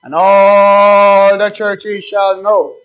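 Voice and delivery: slow proclamation with long-held opening words; solemn scripture reading. Real, not acted. Preacher reading God's warning to the churches, solemn proclamation. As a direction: intone the verse, drawing out key words.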